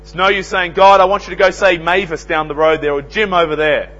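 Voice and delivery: loud, high preaching, slowing on key words; fiery sermon delivery; full-force preaching. Acted. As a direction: thunder it out from the pulpit. Preacher builds with loud exhortation.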